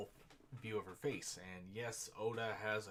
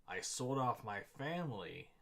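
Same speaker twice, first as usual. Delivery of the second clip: loud declaration; 2 semitones higher, 7 dB louder than usual. Acted. Character's proud bitter confession.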